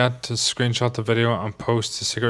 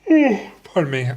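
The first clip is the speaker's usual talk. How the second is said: words stretched by a yawn; 9 semitones higher, slower than usual. Real, not acted. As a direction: talk through a yawn. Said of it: "I have to apologize for yawning".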